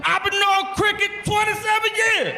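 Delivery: over-the-top eager witness shout; street witness impression. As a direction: shout it like a proud old friend. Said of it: "That's a perfect fucking impression".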